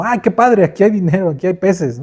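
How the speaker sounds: high, eager mock delight; a greedy little "how great!"; mock excitement. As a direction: act thrilled like someone who just found free money. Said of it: "No fue como".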